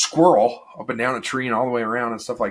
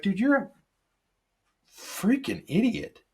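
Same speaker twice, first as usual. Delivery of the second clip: much higher, slow incredulous jab; exasperated retort; 12 semitones higher, slower than usual. Retold or imitated. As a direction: drag out the insult in disbelief. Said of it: "And I was like".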